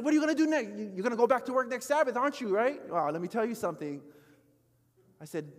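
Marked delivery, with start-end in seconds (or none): 0.0-2.8 mock accusing. Pastor mock-accuses his church.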